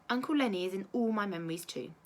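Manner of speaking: plain reading voice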